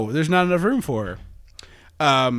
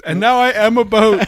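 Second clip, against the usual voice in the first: dramatic declaration; 8 semitones higher, 9 dB louder than usual. Retold or imitated. Dramatic loud quote.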